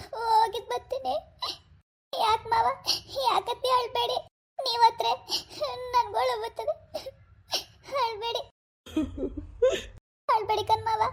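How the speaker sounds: high-pitched tearful pleading; crying. Acted. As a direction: comfort him while crying yourself, pitched high. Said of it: "ನೀನ್ ಅಳ್ಬೇಡ". (ಅಳುತ್ತಾ ಸಮಾಧಾನ ಮಾಡುವ ದನಿ)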